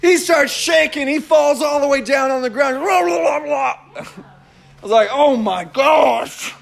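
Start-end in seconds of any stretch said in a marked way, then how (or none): 2.8-3.8 mimicked babbling. Mimics possessed kid falling. 5.1-6.6 slow, drawn-out, higher exclamation of shock; mock shock. Retells his shocked reaction.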